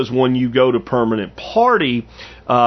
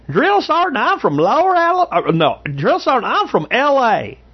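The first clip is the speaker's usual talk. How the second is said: much higher Southern drawl; slow, high Southern recruit answering in a drawl; Yates's Southern drawl; 12 semitones higher than usual. Retold or imitated. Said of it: "he talked with a Southern drawl".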